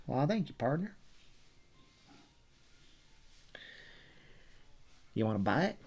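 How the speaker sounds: loud, hammed-up Texas twang; Texas accent. Artist answers an imagined fan, loud Texas accent. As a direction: answer loudly as a folksy Texan. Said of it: "I'd answer 'em back in my Texas accent".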